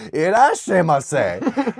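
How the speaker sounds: put-on slow, high, drawn-out American-accented Japanese; foreigner's bad Japanese. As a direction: imitate a foreigner slowly mangling Japanese.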